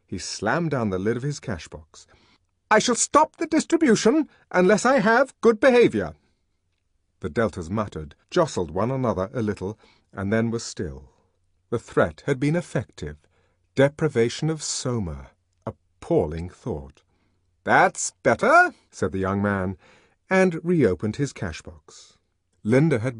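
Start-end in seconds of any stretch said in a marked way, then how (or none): 2.7-6.2 high, loud stern threat; threatening. A narrator's reading. Deputy sub-bursar threatening the deltas, angry. Furious official threatening the crowd. 17.6-18.7 satisfied. A narrator's reading. Official satisfied after threat.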